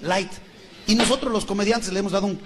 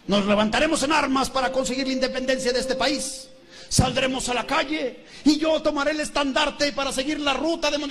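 Miguel's rousing speech; 5 semitones higher than usual. Acted. Miguel addressing the crowd for independence, grand declamation. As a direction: proclaim an uprising to the crowd.

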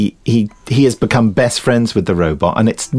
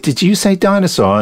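raised, faux-surprised question; mock double take; 8 semitones higher than usual. The narrator imitates his own joke with faux surprise. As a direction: feign surprise at the word. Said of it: "and I said".

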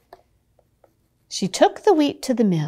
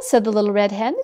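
plain reading voice

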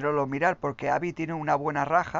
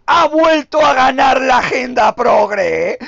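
loud, high, slow mocking shout; sarcastic mock outrage; 9 semitones higher, 14 dB louder, slower than usual. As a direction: parody the complainers' outrage. Mocking the anti-woke complaint. (burla indignada a gritos)

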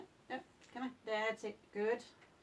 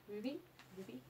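ordinary delivery

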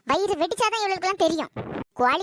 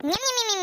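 chomping noises; 5 semitones higher than usual. Acted. Mother chewing noisily.

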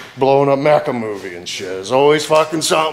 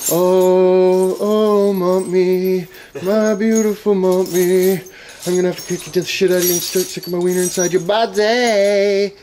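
mock love song; 7 semitones higher than usual. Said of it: "singing a love song to my mummy".

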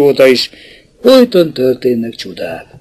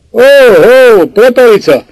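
higher, louder call up to him; hailing a worker high on a scaffold; 8 semitones higher, 3 dB louder than usual. A narrator's reading. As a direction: shout his name upward. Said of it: "Kiáltott utána".

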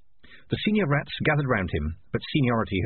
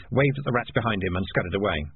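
plain reading voice

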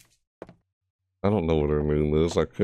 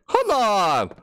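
exasperated shout; 13 semitones higher than usual. Real, not acted. Lights turned out in game.

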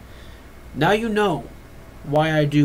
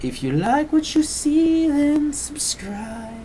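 high, slow outro sign-off with a sung ding; channel intro; 7 semitones higher, much slower than usual. Acted. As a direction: sign off like a cheerful host. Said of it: "I keep forgetting my intro".

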